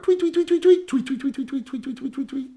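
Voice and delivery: high, chirpy tweets, fading toward the end; songbird tweets. Pretend. Little songbird near the band shell, high chirpy tweets. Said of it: "a little songbird".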